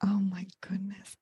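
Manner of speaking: real awed half-whisper, very soft; hushed excitement. Real, not acted. Interviewer hears the screenplays are close, hushed excitement. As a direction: whisper it, overcome with anticipation. Hushed awe at news of sequel.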